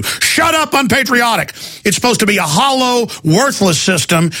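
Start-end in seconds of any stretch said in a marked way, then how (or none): 0.0-1.5 high-pitched mock retort; mock heckler outburst. Patriot silencing a dissenter, high mock outrage. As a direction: cut them off as a scandalized heckler.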